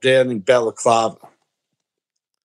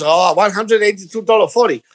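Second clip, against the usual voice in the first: higher-pitched mock Chinese shopkeeper accent; 4 semitones higher than usual. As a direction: imitate the shop owner's Chinese accent. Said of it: "if you do an accent of a foreign person".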